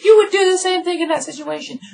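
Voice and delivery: very high, loud falsetto; squeaky hammed-up accusation; mock arguing girl. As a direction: argue back in a parody girl voice. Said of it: "she's like".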